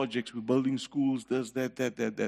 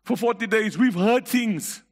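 raised, driving pulpit preaching; congregation-stirring sermon voice, raised and insistent; fiery preaching; 7 semitones higher, 4 dB louder than usual. Acted. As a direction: preach it out to the congregation, full force. Preacher building at full force.

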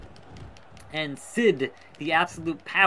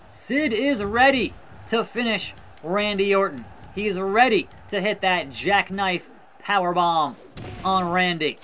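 normal talking voice

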